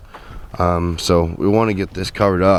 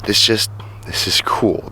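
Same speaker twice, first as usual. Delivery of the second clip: whisper; slower than usual. Real, not acted. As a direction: breathe it out, slow and hushed. Whispered aside on the walk.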